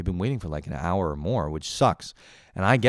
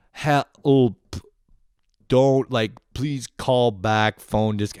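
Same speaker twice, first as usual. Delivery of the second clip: higher, louder, slow halting plea; mock panic; 4 semitones higher, 7 dB louder, much slower than usual. Slow-typing customer begging for a callback: lightly higher mock panic.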